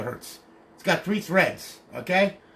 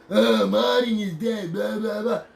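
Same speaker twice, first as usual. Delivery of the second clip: mocking quote; 5 semitones higher, 3 dB louder, slower than usual. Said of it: "when people say that".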